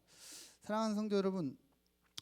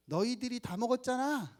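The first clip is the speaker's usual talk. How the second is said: put-on high, louder indignant little girl; high, louder, furious little child whining at mom and dad; huffing toddler; 8 semitones higher, 5 dB louder than usual. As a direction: play a fuming two-year-old accusing her parents. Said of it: "씩씩거리면서".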